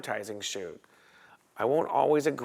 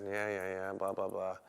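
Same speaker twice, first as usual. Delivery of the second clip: dismissive; 4 semitones lower, 10 dB quieter than usual. Retold or imitated. Brushing off the agent's retold threat.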